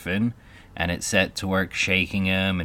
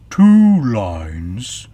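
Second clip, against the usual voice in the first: loud, slightly raised Mock Turtle cry; 4 semitones higher, 11 dB louder than usual. A narrator's reading. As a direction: call out a correction loudly. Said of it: "cried the Mock Turtle".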